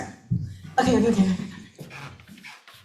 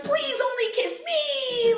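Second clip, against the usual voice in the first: high, slow crying whine; hammed-up weepy begging; whiny mock plea; 13 semitones higher, slower than usual. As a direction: whimper it like a needy lover. Mock whiny quote of herself.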